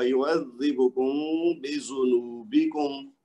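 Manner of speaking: slow یعذبکم بذنوبکم, meem lengthened as a nasal hum before the ba; ikhfa with ghunnah. Shown as a demonstration. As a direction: hide the meem of یعذبکم in a long hum, then recite بذنوبکم. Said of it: "میم کو تھوڑا سا لمبا کریں گے".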